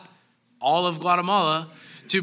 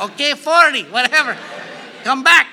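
louder, higher call after someone leaving; vendor calling out; 6 semitones higher, 7 dB louder than usual. Retold or imitated. Market vendor calling after a walking tourist, loud call. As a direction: shout after the buyer to come back.

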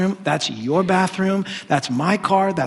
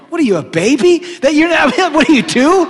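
loud, higher scolding; mocking rebuke; 9 semitones higher, 7 dB louder than usual. Real, not acted. Mocking rebuke of childish spouse.

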